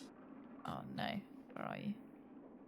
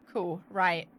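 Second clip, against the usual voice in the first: ordinary delivery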